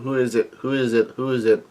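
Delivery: plain reading voice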